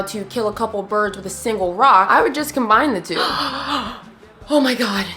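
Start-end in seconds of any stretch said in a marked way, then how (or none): 3.2-4.0 mock gasp. Mock gasp at own idea.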